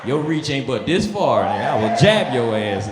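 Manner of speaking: low, cocky trash talk; cocky swagger. Retold or imitated. Walking past armed guards, low cocky taunting. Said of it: "I'm walking through like".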